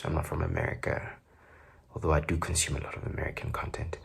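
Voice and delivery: quick whispered confession; whispered aside. Real, not acted. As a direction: whisper it like a secret to the camera.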